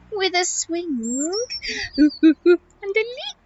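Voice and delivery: high voice with monkey hoots; excitable monkey. Acted. Monkey answering how she'll cross jungle, high and hooting. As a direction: pipe it high, hooting midway.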